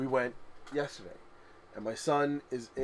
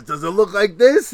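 put-on amused old man; 8 semitones higher, 16 dB louder than usual. Bald man joking.

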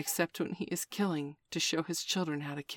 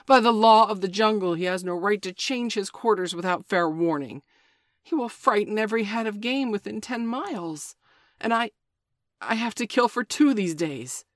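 loud angry wolf; 5 semitones higher, 8 dB louder than usual. Acted. Father Wolf protests the tiger's move, angry. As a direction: bark it out in anger.

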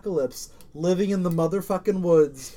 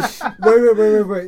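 loud insistent interruption; urgent loud wait; 5 semitones higher, 10 dB louder, slower than usual. Real, not acted. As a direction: cut in loudly, insistently.

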